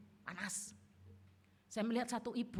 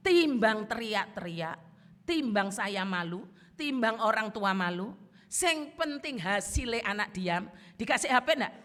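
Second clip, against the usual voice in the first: emphatic loud preaching; 8 dB louder than usual. Acted. Pengkhotbah menekan dengan keras.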